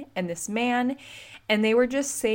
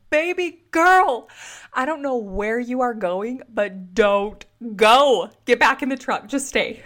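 loud, higher, drawn-out mock plea; imagined yell; 4 semitones higher, 7 dB louder than usual. Onlooker to a departing stranger, hammed-up pleading yell. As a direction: holler dramatically, stretching the key words. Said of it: "wanted to yell at her".

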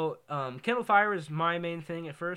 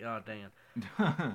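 low, slow retold letdown; mock disappointment; 6 semitones lower, 3 dB quieter, much slower than usual. Kid finding the box was not a dirt bike, let down. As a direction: sag into a drawn-out, lowered mock groan.

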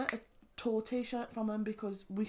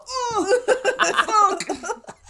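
startled; 13 semitones higher, 11 dB louder than usual. Retold or imitated. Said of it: "she's like".

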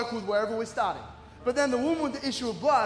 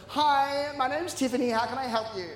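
imitated call-centre girl. Phone agent greets an angry customer, imitated cheeriness. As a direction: put on a chirpy phone-agent voice. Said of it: "this girl goes".

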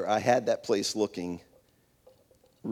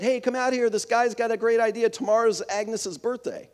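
much higher, excited call to the back room; excited shout, pitched way up; shouting to his wife; 9 semitones higher than usual. Retold or imitated. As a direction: yell it out eagerly to the kitchen. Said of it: "he shouted".

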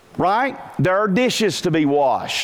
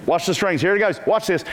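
ordinary talk, nothing marked

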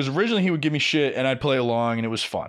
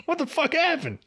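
high, laughing disbelief; amazed, laughing; 11 semitones higher than usual. Retold or imitated. Coworkers stunned he vanished, laughing and high. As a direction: gasp it out through a laugh, much higher. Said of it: "we'd all just be like".

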